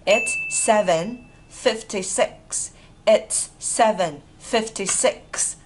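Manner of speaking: very slow, deliberate number reading; slow model. Shown as a demonstration. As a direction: pause between each part of the time. Teacher models the time slowly.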